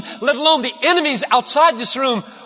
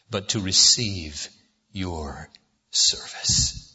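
fading to a whisper on the last words; hushed prayer; 7 dB quieter, slower than usual. Acted. Pastor whispering the end of his prayer to Jesus, fading out. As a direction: let it drop to a breath.

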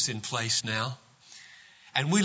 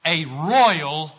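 usual speaking voice

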